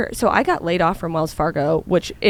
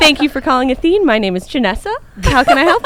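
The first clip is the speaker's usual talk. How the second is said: high, loud cheery phone greeting; customer service voice; 5 semitones higher, 7 dB louder than usual. Shown as a demonstration. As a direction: perform your sunny call-center voice. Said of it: "Can we hear it right now".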